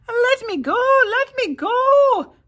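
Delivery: very high, loud, slow shriek of a tiny man; shouting leprechaun. A narrator's reading. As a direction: squeal to be let go. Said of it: "the leprechaun shouted".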